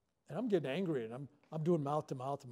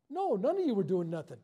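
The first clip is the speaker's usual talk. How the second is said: sharp, louder denial; loud retort; 5 semitones higher, 7 dB louder than usual. Retold or imitated. Lifeguard contradicting the girl, loud and blunt. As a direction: shut her down. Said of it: "I said".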